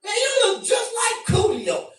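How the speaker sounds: high shouted mocking voice; mock stranger. A stranger comparing him to [name], high mocking shout. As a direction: squeal it like a pushy stranger. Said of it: "motherfucker be like".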